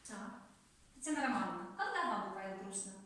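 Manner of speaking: ordinary delivery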